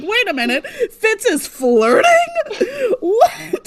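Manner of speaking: high, loud mock disbelief; retold shock. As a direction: stretch it out, scandalised and incredulous. Retelling own shocked reaction to scene.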